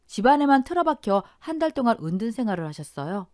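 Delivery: ordinary delivery, nothing marked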